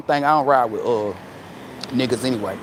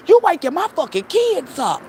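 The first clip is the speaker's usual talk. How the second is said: higher and louder, hammed-up fury; mock angry yell; 10 semitones higher, 5 dB louder than usual. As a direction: bark at him for calling late. Same retold angry answer.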